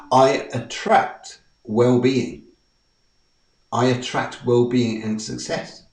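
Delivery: slow, deliberate affirmation. Shown as a demonstration. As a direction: let each word land slowly.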